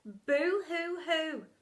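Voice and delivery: slow hammed-up boo-hoo; slow comic boo-hoo; mock sobbing. A narrator's reading. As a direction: wail a comic sob. Family weeping.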